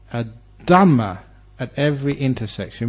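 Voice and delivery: ordinary talk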